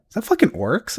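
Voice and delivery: high incredulous question; retold disbelief. Retold shock at seeing boss.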